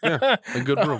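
giggling repeat of "a good broom"; laughing. Real, not acted.

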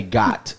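"got" with a hard final T; just the word "got", clipped. Shown as a demonstration. Showing how people really say God, "got". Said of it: "people say".

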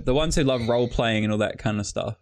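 ordinary delivery

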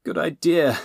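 strained high gasp; winded under a pile of bodies. A narrator's reading. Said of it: "Robin gasped".

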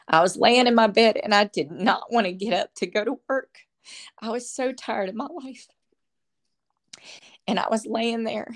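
holding back tears while telling it; tearful. Real, not acted. Telling her friend about hearing Jesus, tearful and breaking. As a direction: voice high and breaking with tears, real. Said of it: "And I'm so sorry if I cry".

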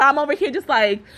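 ordinary delivery

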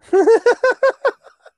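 big shrieking laugh; cracking up at the bush joke; loud burst of laughter. Real, not acted.

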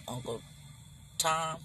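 ordinary delivery

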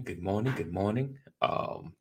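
ordinary talk

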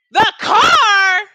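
high, loud, disbelieving shout; outraged shout. Real, not acted. Hearing the rumor from a friend, outraged high shout. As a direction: blast out the words in shock.

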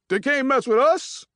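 cocky boast. Acted. Sean boasting after winning.

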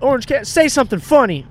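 high, loud mock prompting; pushy promo-host parody; imitated hype woman. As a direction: egg someone on for the camera. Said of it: "she's like".

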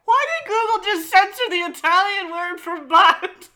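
high, loud, cracking up; real incredulous outburst through laughter; laughing disbelief. Real, not acted. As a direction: demand it loudly while losing it laughing. Cracking up at Google's silent answer.

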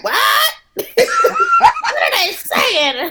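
high, laughing, drawn-out bewildered cry; mock confused elder. To a friend, imitating future confused older millennials, high and laughing. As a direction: play a baffled old person who can't follow. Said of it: "you too will be like".

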